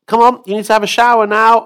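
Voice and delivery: high, loud call; mother calling. Acted. Mother calling her son to shower, high and loud. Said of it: "So his mother says".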